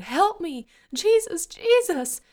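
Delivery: very high, screamed plea; agonized cry. A narrator's reading. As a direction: scream for help, wailing in pain. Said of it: "he cried".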